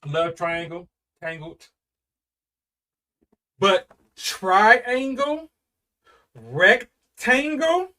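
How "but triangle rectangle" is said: very slow, rising, dragged out; slow mock repeat. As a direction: savor each word in disbelief. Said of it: "said that shit so slow".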